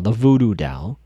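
usual speaking voice

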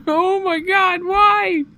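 high, wailing mock despair; hammed-up exasperated cry; anguished screaming. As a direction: wail it loudly down the phone. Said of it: "just screaming into the phone".

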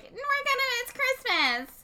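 very high, stretched-out mock wail; hammed-up high-pitched lament; high mom whine. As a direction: imitate a distraught mom in a squeaky-high voice. Retelling mom's upset outburst.

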